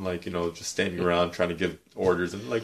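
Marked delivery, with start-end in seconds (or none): none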